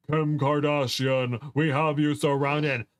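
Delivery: slow, drawn-out mock megaphone announcement; lightly imitated megaphone. A narrator's reading. Boyfriend surrounds the store, mock megaphone call. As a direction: suggest a megaphone with your voice. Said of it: "A voice distorted by a megaphone".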